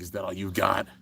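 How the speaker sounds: low, quiet villain taunt; hushed, low mock-villain menace; menacing. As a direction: sneer it softly in a deep, threatening voice. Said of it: "Lane's just looking menacing".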